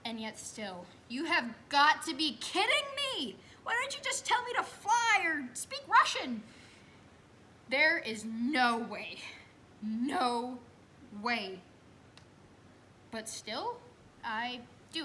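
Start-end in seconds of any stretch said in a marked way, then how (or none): 1.1-6.4 loud and much higher, incredulous; outraged disbelief. Retold or imitated. Mother to the doctor, loudly incredulous. 7.7-11.6 loud refusal. Retold or imitated. Refusing to push.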